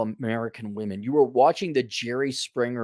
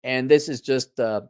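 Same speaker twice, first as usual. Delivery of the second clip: normal talking voice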